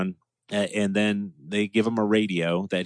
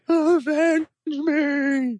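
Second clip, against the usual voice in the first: very high hammed-up dying cry; overacted death scene; much slower than usual. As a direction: wail it like a dying hero. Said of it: "the best line ever".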